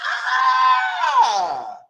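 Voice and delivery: very high, loud laughing scream; real laughing shriek at the kiss. Real, not acted. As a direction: shriek with shocked delight. Shrieking at the hump.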